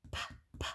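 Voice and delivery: breathy voiceless p, p; whispered p sound. Shown as a demonstration. Teacher sounding out p for kids, softly. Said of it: "What letter says p?".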